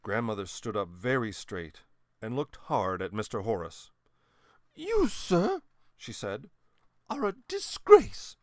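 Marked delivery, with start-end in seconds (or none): 4.7-5.6 put-on high, loud stern old lady; a grandmother scolding a cheat. A narrator's reading. Grandmother condemns Horace. 7.1-8.4 put-on high, loud, slow contemptuous old lady; scornful grandmother. A narrator's reading. Grandmother condemns Horace.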